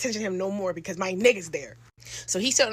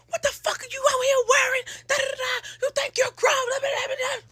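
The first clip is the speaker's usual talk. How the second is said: mother yelling. Retold or imitated. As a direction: blast it like an outraged mom.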